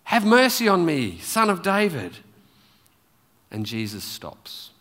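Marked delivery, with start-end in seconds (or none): 0.0-2.2 higher-pitched persistent plea; mildly raised cry, high then falling; calling out. Retold or imitated. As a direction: keep calling out for mercy. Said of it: "he keeps calling out".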